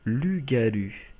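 Lugalu, très lent, à la japonaise, chaque syllabe étirée; accent japonais. Pretend. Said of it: "Avec un petit accent japonais". (Lugalu prononcé lentement avec un accent japonais)

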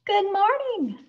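high, loud, drawn-out wake-up call; brisk nurse greeting. A narrator's reading. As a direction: nurse sing-songing to a sleepy patient. Nurse wakes patient next day.

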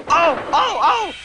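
high, squealing little cries; crying van. A narrator's reading. Brake van crying out after a shove, high yelps. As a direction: yelp as if bumped hard. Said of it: "cried the van".

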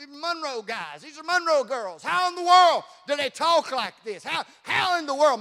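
normal talking voice

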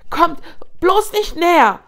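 loud, high warning; threatening. A narrator's reading. Cornered cub warning off the hyenas, loud threat. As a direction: threaten them with lips pulled back. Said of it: "drohte Nuru mit zurückgezogenen Lefzen".

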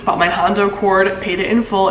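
ordinary talk, nothing marked

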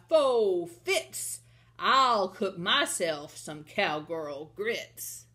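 loud, drawn-out giant chant, each syllable falling; big, menacing storybook giant; thundering giant. Acted. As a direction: boom it out slowly like a hungry giant. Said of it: "the giant's voice thundered".

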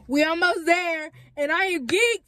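high-pitched, hyped-up energy; geeked, energy turned way up; excited hollering. Real, not acted. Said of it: "wondering why I'm hollering".

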